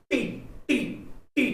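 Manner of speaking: "टी" in short isolated hits with pauses between; stress on टी. Shown as a demonstration. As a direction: repeat "टी" as a stressed beat. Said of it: "टी में stress दे रहे हैं". ("टी" पर अलग-अलग ज़ोर)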